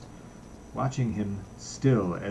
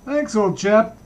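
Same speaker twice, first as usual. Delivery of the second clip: loud, much higher brisk call; brisk cry; 11 semitones higher, 9 dB louder, slower than usual. Acted. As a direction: cheerfully shout thanks across the air. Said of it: "he cried briskly".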